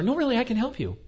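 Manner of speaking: high, chirpy insistence; a perky clerk who won't be told no; insistent perky clerk. Retold or imitated. Clerk insisting, voiced higher.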